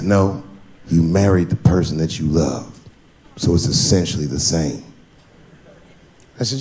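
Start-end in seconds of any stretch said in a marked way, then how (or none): 0.0-4.8 friend voice. Retold or imitated.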